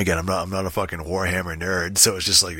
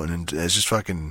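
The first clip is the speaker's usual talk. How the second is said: normal talking voice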